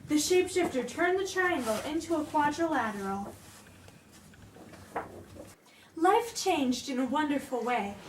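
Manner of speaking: put-on soft, gentle read-aloud for children; storybook narration for the kingdom; story reading. Reads picture book aloud.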